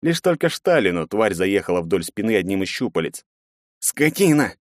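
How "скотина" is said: high-pitched pained cursing; ругань от боли. A narrator's reading. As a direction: curse the beast through the pain. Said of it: "ругался тот, морщась от боли". (ругаться, морщась от боли)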